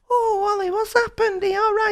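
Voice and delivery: high-pitched fussing mum impression; imitating mum. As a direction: do a worried mum, voice pitched right up. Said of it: "my mum turns up in".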